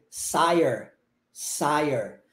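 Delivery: sire said like sai-er, low; medieval pronunciation. Shown as a demonstration. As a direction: say sire with the vowel as in eye. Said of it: "com o I com som de I".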